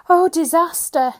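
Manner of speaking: high loud terrified scream. Acted. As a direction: scream in panic. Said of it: "he screamed".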